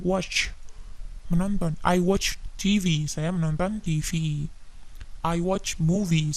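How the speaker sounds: ordinary delivery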